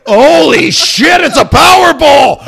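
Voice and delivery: yelling out in shock, high and loud; retold alarmed yell; startled shout. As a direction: blurt it like you just saw disaster hit. Retelling spotting the ball on truck.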